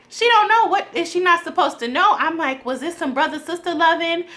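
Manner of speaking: high, loud bewildered questions; incredulous. Retold or imitated. Vlogger asking viewers about the twist, high and incredulous. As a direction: demand answers in disbelief. Shocked reaction retold.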